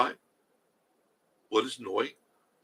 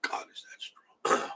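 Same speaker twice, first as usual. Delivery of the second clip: slow, breathless whisper at the smell; 4 dB quieter, slower than usual. Real, not acted. Reacting to a strong smell on stream, slow whispered gasp.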